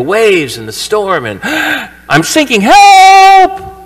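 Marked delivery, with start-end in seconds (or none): 1.5-2.0 startled gasp of someone going under; a sudden fearful intake of breath; sharp high gasp. Acted. Peter sinking in the waves, a sharp gasp. 2.1-3.7 high, loud desperate shout; panicked cry for help. Acted. Peter sinking in the storm, crying out to Jesus in panic.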